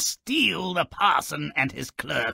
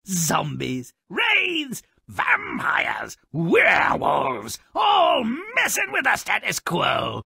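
ordinary talk

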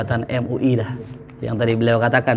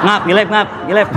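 laughing mock gibberish; 5 semitones higher than usual.

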